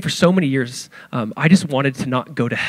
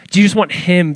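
usual speaking voice

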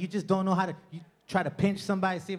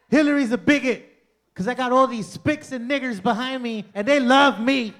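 Donald Trump; 5 semitones higher, 5 dB louder than usual. Retold or imitated. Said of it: "That's really how he's talking".

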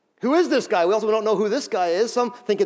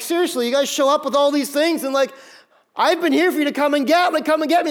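exasperated Jesus; 8 semitones higher, 4 dB louder than usual. Retold or imitated. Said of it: "And he's like".

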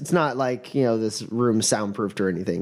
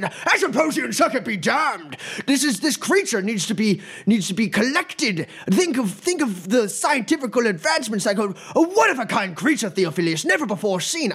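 yelling professor; 9 semitones higher than usual. Acted. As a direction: berate the dean to get his way. Professor ranting.

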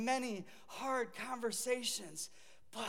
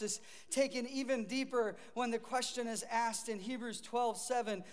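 normal talking voice

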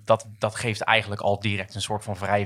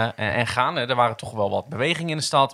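ordinary talk

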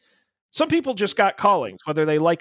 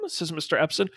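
ordinary talk, nothing marked

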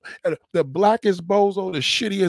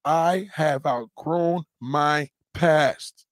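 slow deliberate affirmation; much slower than usual. Shown as a demonstration. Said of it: "Say these five words to yourself".

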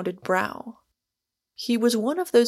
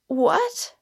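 higher-pitched startled cry; cry, voice breaking; 9 semitones higher than usual. A narrator's reading. The girl reacts to Michael's news, crying out brokenly. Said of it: "there was a break in her voice".

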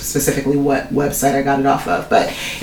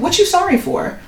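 incredulous retort. Retold or imitated. Retorting to needless apology.

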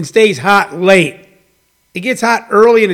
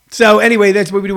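usual speaking voice

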